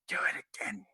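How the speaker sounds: heckling audience member in a softened, high voice; Grayface. Acted. Creative posing as audience member advises wrestler, hushed heckle. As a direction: coach from the crowd in a hushed strained call. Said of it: "posing as an audience member".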